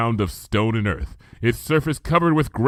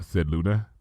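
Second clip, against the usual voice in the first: plain reading voice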